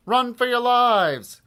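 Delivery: high, loud, drawn-out yell; alarmed cry. A narrator's reading. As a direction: shout a panicked warning slowly and fully. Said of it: "cried Harold".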